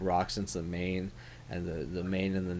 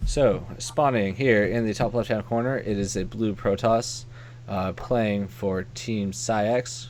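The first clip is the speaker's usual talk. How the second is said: slow, louder caster announcement; announcer voice; 4 dB louder, slower than usual. Acted. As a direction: present the players like a shoutcaster. Announcing players at game start.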